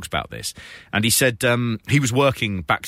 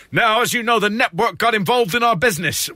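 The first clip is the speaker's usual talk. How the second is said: higher, louder mock announcement; Eric Bischoff impression; 11 semitones higher, 4 dB louder than usual. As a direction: ham up a wrestling boss promo. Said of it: "he just goes".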